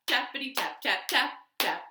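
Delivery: usual speaking voice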